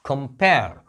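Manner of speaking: Compare as kum-PAIR, PAIR punched and falling; short weak kum, strong PAIR in compare; stress on second syllable. Shown as a demonstration. Teacher showing the comparison verb, compare stressed on PAIR. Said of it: "यहाँ stress है".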